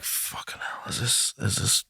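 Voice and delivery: hushed, whispered mock dismay; acted appalled whisper under the breath; horrified whisper. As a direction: whisper it as an awful realisation dawns. Acts sober person's dawning horror.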